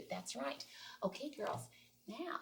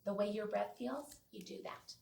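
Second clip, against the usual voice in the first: ordinary delivery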